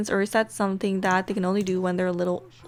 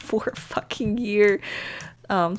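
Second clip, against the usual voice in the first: ordinary talk, nothing marked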